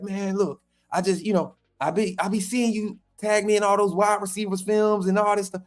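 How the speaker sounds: the coach noting the tags, in his voice; Jamarcus Shepherd voice. Retold or imitated. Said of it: "this my Jamarcus Shepherd voice".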